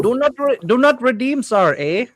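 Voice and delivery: high, loud mock Indian-Canadian accent. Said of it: "the Toronto accent also sounds like an Indian accent".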